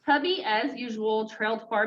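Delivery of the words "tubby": usual speaking voice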